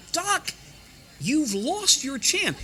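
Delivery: exasperated. Retold or imitated. Listener at home pleads with the late announcer, exasperated. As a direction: despair at the announcer who missed the cue. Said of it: "I'd be sitting here going".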